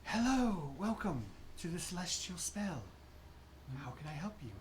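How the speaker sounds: a hint of a dreamy distant shopkeeper; light airy floating voice; airy ethereal voice. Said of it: "airy, ethereal voice".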